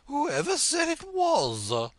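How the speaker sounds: high, loud, slow haughty queen; imperious Red Queen. Acted. Red Queen testing Alice on French, high and haughty. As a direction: quiz her grandly, drawing out fiddle-de-dee.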